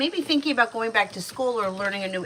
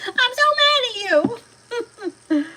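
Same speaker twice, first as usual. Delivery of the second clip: high, loud mock tantrum voice; imitating a child's furious yell; imitated angry yell; 8 dB louder, faster than usual. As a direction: squeal it out in pretend fury. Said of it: "when she yells at me like that".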